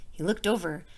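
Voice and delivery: plain reading voice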